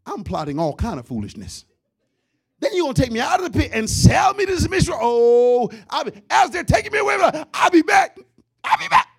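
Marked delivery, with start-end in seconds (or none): 2.6-9.1 loud, high shouted threat; mock furious vow. The pastor pretends to be Joseph shouting revenge at his brothers, loud. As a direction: yell it back as they drag you off. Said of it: "Let's be real".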